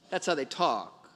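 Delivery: ordinary talk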